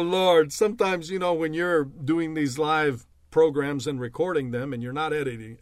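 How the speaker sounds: usual speaking voice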